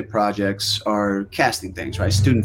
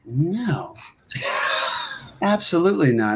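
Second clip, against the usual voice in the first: mock refusal; 8 semitones higher than usual. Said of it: "these other guys were like".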